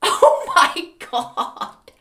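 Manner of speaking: high, loud, long-drawn laughing exclamation; laughing disbelief. Real, not acted. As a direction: let disbelief stretch out through a laugh.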